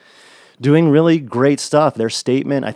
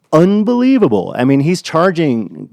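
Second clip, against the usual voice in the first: excited; 6 semitones higher, 7 dB louder than usual. Real, not acted. Raving about the amplifiers.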